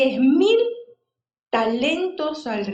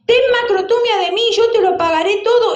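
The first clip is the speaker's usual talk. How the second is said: higher, louder, fast desperate begging; urgent plea; 7 semitones higher, 7 dB louder, faster than usual. Acted. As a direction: cry out to the master for mercy. Servant's plea retold more urgently.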